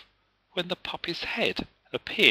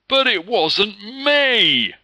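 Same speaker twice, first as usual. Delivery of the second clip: loud, high, slow wail of innocence; bully whining he is innocent; whining protest; 11 semitones higher, 9 dB louder, much slower than usual. A narrator's reading. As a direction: wail it like a caught kid. Lee protests.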